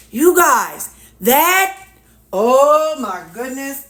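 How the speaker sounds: thrilled and a little giddy; excited. Real, not acted. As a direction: gush over the pudding, slowing on oh my goodness. Thrilled the pudding thickened.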